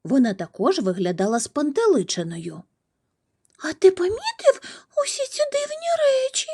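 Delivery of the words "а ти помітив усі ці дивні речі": very high-pitched little squirrel whimper. A narrator's reading. Squirrel asks the crow about strange happenings, whimpering. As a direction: squeak it out like a small, worried animal. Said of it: "проскімлила вона".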